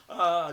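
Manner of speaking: sudden loud, high "ah"; mock panicked yell. Showing how he did not react to police, mock yell. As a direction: throw out a brief mock scream. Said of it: "and not like".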